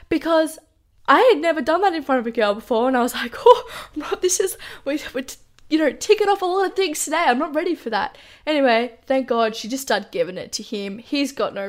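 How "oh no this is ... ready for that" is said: laughing panic. Real, not acted. Telling her viewers about the naked moment, laughing in dismay. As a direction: laugh through the horror of it.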